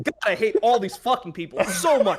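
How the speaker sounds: half-joking hateful outburst; exasperated rant. Real, not acted. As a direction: vent like you're fed up with them all. Exasperated rant about people missing his point.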